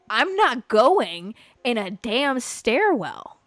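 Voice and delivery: each word slow and firm; slow, emphatic refusal. Real, not acted. Refusing a friend's stairwell idea, slow and firm. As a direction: lay down the law, slowly. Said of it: "Are you serious?".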